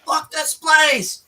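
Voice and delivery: high, drawn-out imitation of a yell; slow, high-pitched mock outburst; mock angry yell. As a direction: imitate an angry man cursing on his way out. Retold angry gambler storming out.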